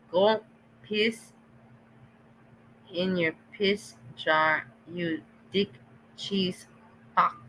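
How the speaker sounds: each word spaced out and slow; deliberate slow quote of the insult; slow mock repeat. Shown as a demonstration. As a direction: spell out the insult word by word, slowly. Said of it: "That's what it was".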